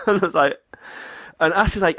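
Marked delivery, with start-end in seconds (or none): none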